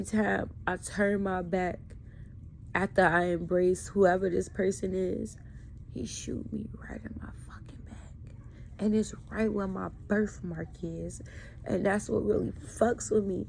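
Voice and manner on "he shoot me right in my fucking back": breathy whispered line, almost no voice; unvoiced whisper of the dream shot; hushed whisper. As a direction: drop to a whisper as if reliving it. Reliving the dream's gunshot.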